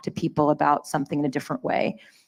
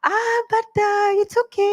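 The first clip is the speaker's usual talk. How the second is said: much higher, sweet and soothing mother voice. Retold or imitated. Mom calming the family debate at the dinner table, sweet high voice. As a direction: play her mom smoothing over the argument, high and gentle. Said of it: "my mom would be like".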